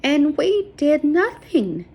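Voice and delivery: slow, high, anguished; bitter grief. A narrator's reading. Sarah grieving over the killed man, slow and anguished. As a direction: drag it out in despair.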